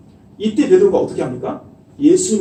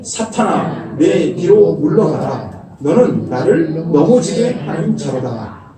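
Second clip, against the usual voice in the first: slow, louder commanding rebuke; stern rebuke; 5 dB louder, slower than usual. Acted. Jesus rebuking Peter, slow and forceful command. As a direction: command Satan to get behind you.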